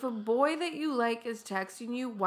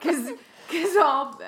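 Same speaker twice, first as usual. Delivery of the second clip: laughing; 6 semitones higher, 5 dB louder, slower than usual. Real, not acted. Laughing at the movie.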